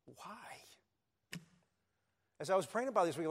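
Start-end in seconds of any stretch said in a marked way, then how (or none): 0.1-1.4 breathy whispered why; whispered question. Retold or imitated. Abraham questioning God in a whisper. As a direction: whisper it, barely there. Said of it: "And Abraham goes, begging the question".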